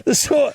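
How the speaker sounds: a high squealing laugh; high burst of laughter. Real, not acted. Laughing at his own clothes punchline, high squeal. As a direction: let a high laugh break out.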